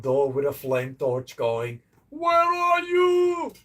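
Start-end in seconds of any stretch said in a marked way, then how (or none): 2.1-3.5 high, slow mock shriek of a hunting wife; angry wife. Imagining an angry wife at his front door, slow high mock shriek. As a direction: drag it out like a furious woman at the door. Angry woman with flame torch.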